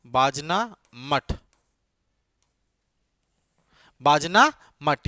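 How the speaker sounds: Bajna मठ said slowly and clearly; slow, careful Bajna मठ for note-taking; slow dictation. Shown as a demonstration. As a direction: draw out Bajna मठ so students can write it. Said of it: "Bajna मठ repeated for notes".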